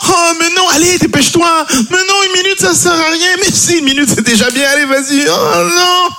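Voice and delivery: high falsetto whine; whining wife. Acted. Wife voiced pleading in sketch.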